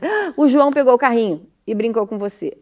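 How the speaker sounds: loud gasp, then jumping in; acting out a mother eagerly finishing for the child; mãe completando a frase. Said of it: "aí a mãe já olha e diz". (mãe interrompendo, alto e afobada)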